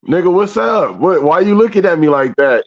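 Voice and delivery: high, pushy challenge; mock confrontation. He confronts his girlfriend over her stare, mock aggressive. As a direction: demand to know what her problem is.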